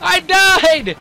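high, loud victory yells; triumphant shouting. Real, not acted. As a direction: celebrate it at full volume. Finally reaches lane and dies.

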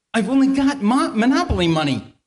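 loud, high-pitched complaint; mock protest. Taxpayer short of money answering tax man, loud mock protest. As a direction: cry poor at full voice, hammed up.

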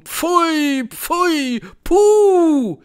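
high, loud cries of revulsion; shouted disgust. A narrator's reading. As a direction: yell like a disgusted audience. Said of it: "schrie das Publikum". (angewiderte Schreie, hoch und laut)

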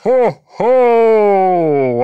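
booming jolly ho ho ho; big hearty Santa laugh. Acted. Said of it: "I'll be Santa".

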